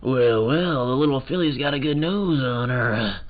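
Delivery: a lightly voiced drawling old-west detective; gruff cowboy.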